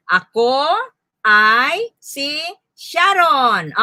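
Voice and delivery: "Ako", "ay", "si", "Sharon" with clear gaps; word by word. Shown as a demonstration. Teacher asking how many words, "Ako ay si Sharon" word by word. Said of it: "ilang salita".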